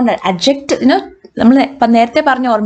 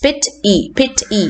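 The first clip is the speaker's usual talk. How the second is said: syllable-by-syllable demo of "pit E", stressed on "pit"; stress on first syllable; faster than usual. Shown as a demonstration. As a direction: punch "pit", then a separate lighter "E". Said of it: "the pit part will be stressed".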